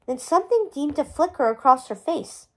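ordinary delivery

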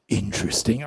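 deep, loud, menacing verdict; low rock-demon voice, sizing someone up; imitated mean-sounding rock star. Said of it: "he says to me in this voice".

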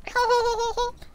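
squeaky cartoon kid laugh; high cartoon giggle. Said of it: "Bobby from Bobby's World".

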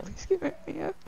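high, whiny fake sob through the words; high whimpering plea, faked; fake crying. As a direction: put on a pleading cry to get the items back. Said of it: "Are you crying?".

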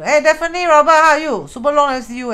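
loud, high, excited hello to friends; calling out greetings. Real, not acted. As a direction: call out to them across the room. Greeting friends in live stream.

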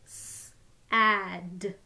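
each sound said separately with a short break; sounds tapped apart. Shown as a demonstration. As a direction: split the word into its sounds, one by one. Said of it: "Let's tap out all the sounds".

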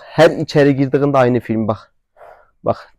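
usual speaking voice

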